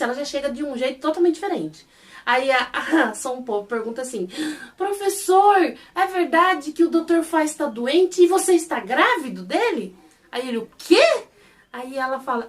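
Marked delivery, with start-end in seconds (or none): 4.8-10.0 higher, louder mock-excited villager; acting out an excited busybody's question; breathless nosy question. Acted gossipy question to professor. 10.6-11.2 high, loud startled question; acted shriek of disbelief; shocked disbelief. Acted shock at absurd rumour.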